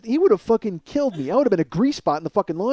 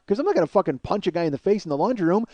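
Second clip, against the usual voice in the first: ordinary talk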